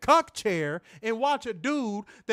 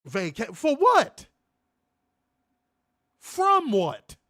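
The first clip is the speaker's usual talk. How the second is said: incredulous, slow and louder; angry rant; 2 semitones higher, 4 dB louder, much slower than usual. Real, not acted. Host mocking the vacation, indignant and loud. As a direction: spit the question back in disbelief. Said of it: "I have not had a rant in a minute".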